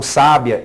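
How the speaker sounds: slow "sábia", stressed SÁ-bia; stress on first syllable. Shown as a demonstration. Teacher contrasts accent placement, stress on first syllable. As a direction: hit the opening SÁ of "sábia". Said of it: "aquela sílaba tem mais força do que outras".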